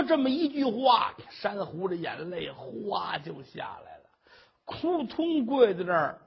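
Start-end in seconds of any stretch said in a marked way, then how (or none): none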